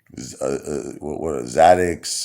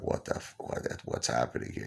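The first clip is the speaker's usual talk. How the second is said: breathy, scared whisper; panicked whisper; 4 semitones lower, 12 dB quieter than usual. Retold or imitated. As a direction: hush it, frightened and confused. Freaking out on the stretcher.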